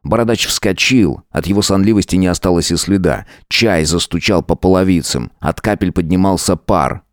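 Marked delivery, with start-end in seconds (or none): none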